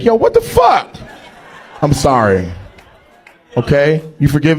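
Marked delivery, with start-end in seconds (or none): 0.0-0.9 high shouted "what the fuck"; mock shouted outburst. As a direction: yell it up high like an outraged audience member.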